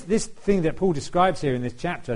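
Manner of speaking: usual speaking voice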